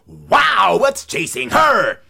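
high, loud startled cry; startled crow. Acted. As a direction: gasp it out like a startled bird.

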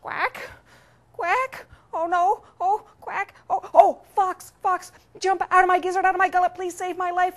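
high, frantic cry for help; panicked duck. Acted. Duck cornered by pecking chickens begs the fox, high panic. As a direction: pitch way up, building to a loud plea.

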